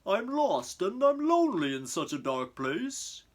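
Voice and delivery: a lost creature crying out in the dark; lonely rhino. A narrator's reading.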